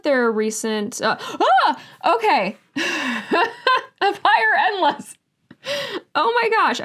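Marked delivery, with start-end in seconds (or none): 1.2-6.8 high-pitched delighted gasp with a laugh; excited surprise. Real, not acted. Book fan spotting a sequel among the add-ons, excited surprise. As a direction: squeal it, much higher, giddy at the find.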